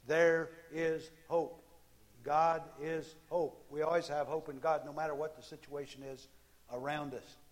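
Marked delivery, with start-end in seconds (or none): 0.1-3.6 slow, loud emphatic proclaiming; slow proclamation. Acted. Preacher proclaiming hope to the congregation, slow and loud. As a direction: proclaim each word of hope slowly.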